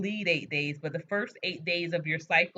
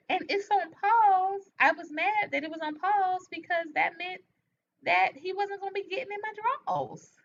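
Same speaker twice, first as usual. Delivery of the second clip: high mock indignation; 12 semitones higher than usual. Real, not acted.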